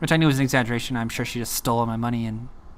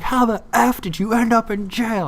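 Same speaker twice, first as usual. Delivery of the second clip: high-pitched, demanding caller; imitating an exasperated friend on a call; 10 semitones higher, 3 dB louder than usual. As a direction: demand an answer in a high, worked-up voice. Friend acted in disbelief.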